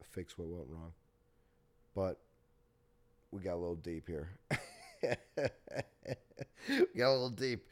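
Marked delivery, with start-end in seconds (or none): 4.5-7.7 high laugh into the words. Real, not acted. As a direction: laugh it off, louder. Laughs off deep moment.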